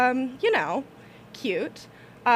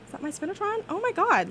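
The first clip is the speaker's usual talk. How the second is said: surprised pitch-up; 7 semitones higher than usual. Real, not acted. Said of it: "my voice just pitched up".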